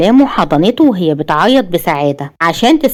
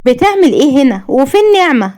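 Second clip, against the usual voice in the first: put-on higher, shy young wife's voice; shy girl; 5 semitones higher than usual. A narrator's reading. Wife finds husband in kitchen, asks shyly. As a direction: ask him timidly, a little higher. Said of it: "وقالت بخجل".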